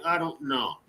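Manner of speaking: ordinary delivery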